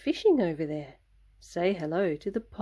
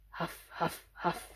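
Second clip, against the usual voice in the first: breathy caribou huff; 2 semitones lower, 10 dB quieter than usual. Acted. Caribou huffing in story.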